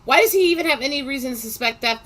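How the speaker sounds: indignant disbelief. Real, not acted. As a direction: stop them in disbelief. Indignant outburst at story.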